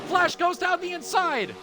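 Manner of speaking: rising excited race call; motorsport commentator. Acted. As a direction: call the final lap, building hype.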